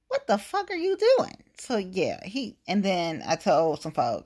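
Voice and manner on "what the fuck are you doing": much higher, stunned what the fuck; incredulous outburst. Retold or imitated. Reacting to the Dakotas staying open, a high incredulous cry. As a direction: yelp it in disbelief. Exasperated quote about the Dakotas.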